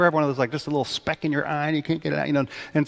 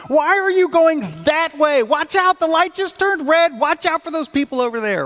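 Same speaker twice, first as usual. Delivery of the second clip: much higher, louder nagging passenger; backseat driver; 13 semitones higher, 4 dB louder than usual. Retold or imitated. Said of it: "helpful suggestions and helpful noises".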